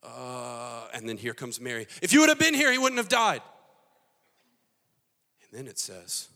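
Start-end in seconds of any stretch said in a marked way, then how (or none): 2.0-3.4 high, shouted reproach; wailing accusation. Acted. Mary arriving to blame Jesus, high mock-hysterical shout. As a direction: burst out in a loud wail.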